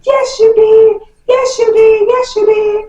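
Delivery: put-on high, slow taunting chant of little kids; sing-song accusation like small children; mock kids yelling. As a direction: kids chanting yes you did. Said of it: "Jody's kids is just yelling".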